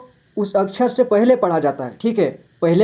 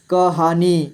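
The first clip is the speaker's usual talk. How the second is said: कहानी split into क, हा, नी; syllable by syllable; slower than usual. Shown as a demonstration. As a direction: draw out each syllable of कहानी. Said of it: "थोड़ा fast पढ़ेंगे". (कहानी को तोड़कर: क, हा, नी)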